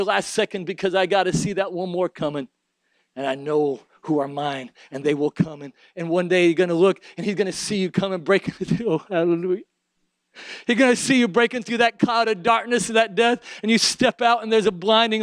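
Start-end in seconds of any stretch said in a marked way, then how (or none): none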